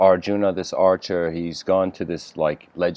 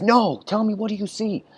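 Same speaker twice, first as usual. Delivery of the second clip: much higher, demanding question; impatient master archer; 13 semitones higher than usual. Retold or imitated. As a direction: press harder, voice up high. Master archer presses again.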